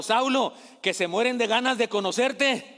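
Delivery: high, loud call with a laugh; shouting out. Acted. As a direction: yell across to him, laughing. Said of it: "le grita a Saulo".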